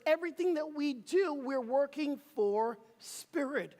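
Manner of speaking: rally the congregation with rising fervor; preaching. Acted. Leads call and response.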